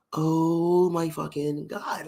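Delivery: long drawn-out mock dismay.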